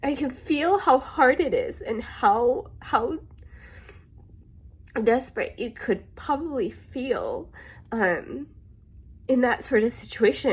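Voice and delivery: fighting tears as she pictures their despair; really emotional. Real, not acted. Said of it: "I'm sorry, I'm getting really emotional".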